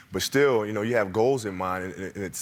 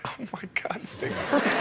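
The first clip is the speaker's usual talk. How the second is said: breathy whispered groan of pain; half-laughing pained whisper; 13 semitones higher, 3 dB quieter, slower than usual. Retold or imitated. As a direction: whisper it like you're hurting on the bench. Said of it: "I'll sit on the bench like".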